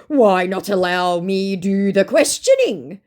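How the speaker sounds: slow, altered voice, a touch pompous; mock scammer voice. Scammer demanding to ask questions, slow put-on voice. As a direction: put on the con man's stiff tone.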